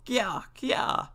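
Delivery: high chattering monkey call. Acted. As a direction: squeak it like a little monkey. Monkey's cry in story.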